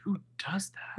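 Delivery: aghast whisper; shocked hushed aside. Real, not acted. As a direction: breathe it out in disbelief. Aghast aside about left keys.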